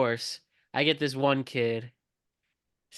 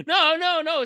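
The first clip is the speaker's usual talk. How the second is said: mock crying refusal; 5 dB louder than usual. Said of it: "Still crying".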